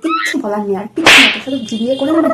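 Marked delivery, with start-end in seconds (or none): 0.9-1.3 much louder, shouted in fury; loud angry outburst. Acted. Fed-up bride shouts at her useless fiancé. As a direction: blow up at him.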